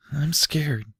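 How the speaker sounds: breathy frightened whisper of a small foal; hushed scared whisper. A narrator's reading. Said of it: "Peenia whispered".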